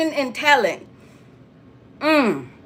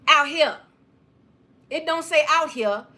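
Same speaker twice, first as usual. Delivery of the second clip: ordinary talk, nothing marked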